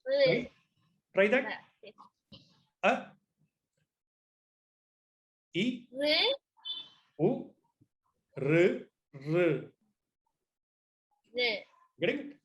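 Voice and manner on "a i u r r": "A", "I", "U", "R" said short, no breath or emphasis; short vowels. Shown as a demonstration. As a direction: clip each vowel short and unaspirated. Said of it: "this is called Hrashva Swara".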